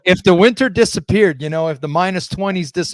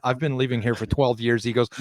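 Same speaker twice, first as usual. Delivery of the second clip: usual speaking voice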